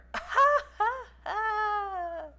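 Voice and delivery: long, high embarrassed laughing squeal; squeal-laugh, drawn out and high; awkward high laugh. Real, not acted. Startled by the option to flirt.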